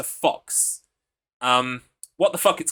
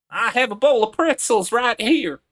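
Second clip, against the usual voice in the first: hammed-up southern US accent; 9 semitones higher, 6 dB louder than usual. Shown as a demonstration. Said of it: "like, Hick accent".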